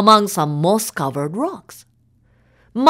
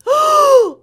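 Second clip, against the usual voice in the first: frightened gasp; 4 dB louder than usual. A narrator's reading. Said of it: "Yoshi went".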